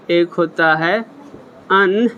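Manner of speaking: ordinary talk, nothing marked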